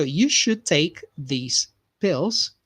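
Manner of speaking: slow, careful model line for students to repeat; unhurried teacher model sentence. Shown as a demonstration. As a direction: lay each word out for learners to copy. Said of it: "talk out loud".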